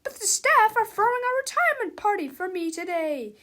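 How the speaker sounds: high, loud teacher voice; stern teacher. A narrator's reading. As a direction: announce it over a noisy classroom. Acted teacher addressing her class.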